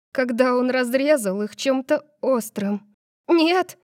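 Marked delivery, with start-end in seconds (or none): 3.3-3.8 high, loud cry of horror; horrified gasp. A narrator's reading. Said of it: "Я ахнула в абсолютном ужасе". (вскрик ужаса)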